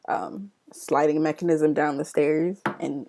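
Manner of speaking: normal talking voice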